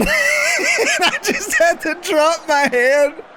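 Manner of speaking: can barely get the story out for laughing; real giggling while telling it. Real, not acted. Retelling embarrassing call, laughing.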